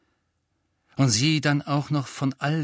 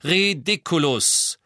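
slow, firm, clearly spoken spell; loud and clear; 5 semitones higher, 4 dB louder, slower than usual. Acted. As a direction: cast it with calm authority. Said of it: "sehr laut und deutlich". (laut, deutlich und langsam gesprochen)

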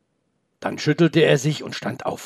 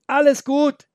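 much higher, louder call; calling back; 13 semitones higher, 4 dB louder, slower than usual. Acted. Boy calls back to his grandpa, loud. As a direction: shout reassurance over the distance. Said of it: "rief er zurück".

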